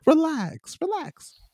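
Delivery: high, soft relax relax; patronizing calm-down; mock soothing. Friend teasing him to relax, high and soft. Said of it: "teasing relax".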